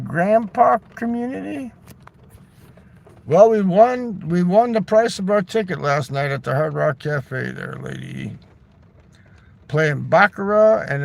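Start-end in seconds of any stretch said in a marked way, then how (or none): none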